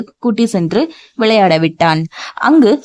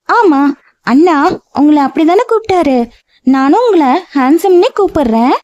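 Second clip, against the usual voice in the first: put-on childlike voice; 7 semitones higher than usual. A narrator's reading. Childlike woman voiced in story.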